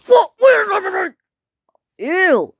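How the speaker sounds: very high, loud mock wail; pretend upset shout. Asking where everything went in play, high shout.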